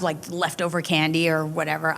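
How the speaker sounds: normal talking voice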